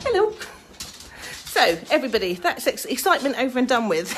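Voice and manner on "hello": high, loud hello at the door; sing-song hello. Real, not acted. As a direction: call it out brightly. Greeting at the door.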